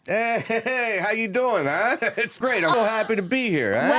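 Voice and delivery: high, lively put-on New York accent; New York accent impression. Comedian greeting host as guest, put-on New York accent. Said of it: "give such a good New York, uh, impression".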